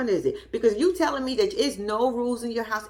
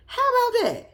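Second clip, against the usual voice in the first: much higher, louder mocking taunt; 4 dB louder than usual. Real, not acted. Taunting the uncle, a high mocking repeat. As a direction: sing it up high to rub it in.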